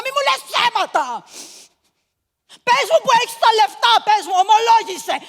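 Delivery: high, very loud screaming demand; raging shout. Retold or imitated. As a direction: scream her down, demand a confession. Aunt screams at the girl.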